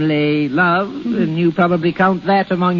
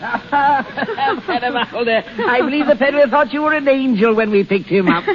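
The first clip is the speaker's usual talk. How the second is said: laughing; 7 semitones higher than usual. Acted. Baron laughs while teasing Alda.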